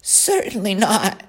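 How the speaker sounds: put-on high, haughty young lamb; a lamb looking down on a pig; snooty lamb voice. The lamb refuses.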